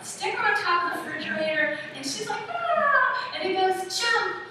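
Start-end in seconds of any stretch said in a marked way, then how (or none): 2.5-3.3 high, loud "ah" squeal; imitated child squeal. Imitates child atop the refrigerator. 4.0-4.4 high, loud playful command; urging shout. Acted. Acts father urging child to jump.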